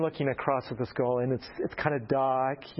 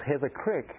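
usual speaking voice

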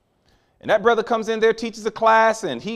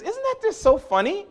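put-on high, gushing young woman; amused college girl; 3 dB louder than usual. Student tells the professor about the book, high and delighted. Student voiced in sermon story.

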